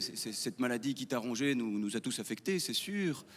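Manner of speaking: low, hushed funeral speech; mock solemn eulogy. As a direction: drop low and soft, as at a graveside. Acted eulogy at mother-in-law's funeral. (oraison funèbre feinte, voix basse)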